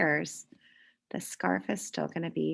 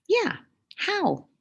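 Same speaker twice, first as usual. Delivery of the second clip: much higher squeaky critter voice; little pet voice; 13 semitones higher, 4 dB louder than usual. Acted. Fluffy the class pet wondering, much higher and squeaky. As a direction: pipe up like a tiny pet.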